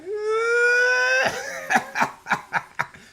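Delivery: high, loud laughing wail; mock wailing cry. Retelling the whipping, a hammed-up laughing wail. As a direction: ham up the crying kid. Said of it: "I just started crying".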